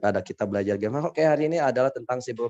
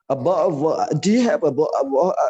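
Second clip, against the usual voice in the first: exaggerated British accent, louder and higher; mock British accent; 5 semitones higher, 6 dB louder than usual. Shown as a demonstration. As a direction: garble it in a hammed-up British accent. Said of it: "British itu yang paling susah". (logat British yang dilebih-lebihkan)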